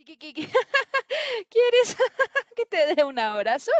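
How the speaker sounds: high-pitched cackling hyena voice; laughing hyena. Acted. Hyena offering a hug to the little leopard, cackling. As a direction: break up the words with shrill cackles. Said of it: "Se ríe la hiena".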